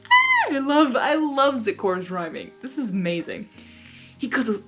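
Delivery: usual speaking voice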